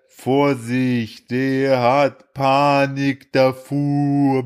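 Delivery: words stretched out extremely slowly; slow-motion imitation. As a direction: mimic a voice heard in slow motion. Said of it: "ich hörte nur das".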